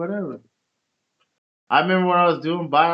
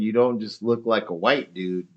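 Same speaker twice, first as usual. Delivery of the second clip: ordinary talk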